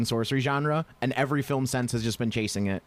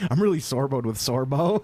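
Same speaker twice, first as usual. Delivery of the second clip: normal talking voice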